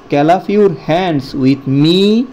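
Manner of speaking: very slow, each word drawn out; slow drill. Shown as a demonstration. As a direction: lead the class slowly in a chant. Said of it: "আমার সাথে তোমরা হাততালি দাও". (ধীরে ধীরে শিক্ষকের মতো বলা)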